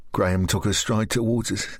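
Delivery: ordinary delivery, nothing marked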